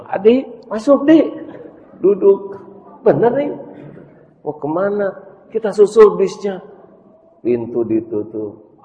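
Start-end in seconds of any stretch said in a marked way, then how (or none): 0.1-6.6 much higher, louder lady voice; high, loud lady's voice asking the boy; rich mother asking. Acted. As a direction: lean out of the car and ask. Mother invites boy into car.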